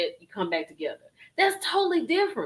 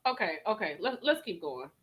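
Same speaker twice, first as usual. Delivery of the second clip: softer, lower, holding back anger; calming himself; 8 semitones lower, 8 dB quieter than usual. Real, not acted. Reactor calms himself after getting heated, softer and lower. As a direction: rein it in under your breath. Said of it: "this run me hot".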